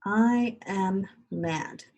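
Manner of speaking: very slow, each word drawn out as it is written; slow dictation. Shown as a demonstration. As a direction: spell it out slowly for children copying it down. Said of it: "So I'm gonna write I".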